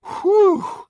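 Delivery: loud, high mock sigh of relief; exaggerated whistle of relief from the driver; relieved whew. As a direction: blow out a big hammed-up whew. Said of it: "the guy's just like ... sigh of relief".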